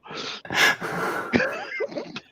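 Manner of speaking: breathless high-pitched laughter; cracking up at his own story; high wheezing laugh. Real, not acted. Laughing hard after telling the truck story, high wheeze.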